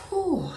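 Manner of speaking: loud, high exhale of relief; real sigh of sudden relief; relieved whew. Real, not acted. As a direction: let out a big "whew" of relief. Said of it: "I just felt a big relief".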